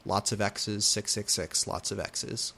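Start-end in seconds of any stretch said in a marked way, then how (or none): none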